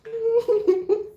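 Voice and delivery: high burst of laughter; real giggle. Real, not acted. Laughing after quote.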